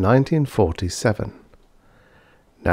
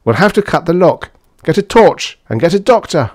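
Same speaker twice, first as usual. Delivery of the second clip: high, raised emergency voice; urgent orders; 10 semitones higher, 6 dB louder than usual. A narrator's reading. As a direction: call out commands with alarm. Emergency orders outside locked room.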